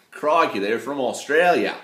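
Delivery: loud, hammed-up Monty Python Australian; mock Australian, pushed loud like a comedy sketch; bad Australian accent. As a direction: exclaim it in a badly faked Australian accent. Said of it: "my bad Monty Python Australian accent".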